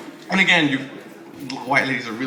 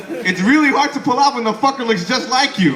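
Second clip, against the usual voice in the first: ordinary talk, nothing marked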